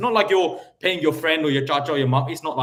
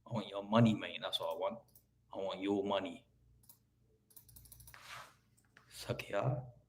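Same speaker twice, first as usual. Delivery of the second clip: quiet, low hammed-up menace; a hint of a hard man demanding his money; lightly voiced threat, softer and lower than his own voice; mock tough talk; 5 semitones lower, 12 dB quieter than usual. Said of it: "By the way, I never speak like that".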